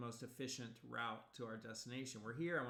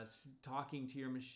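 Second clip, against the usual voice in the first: ordinary delivery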